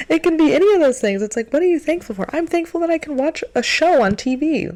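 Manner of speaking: a giddy rush of happiness over the little things; giddy excitement. Real, not acted. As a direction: gush about it, nearly laughing. Gushing about silly things she's thankful for.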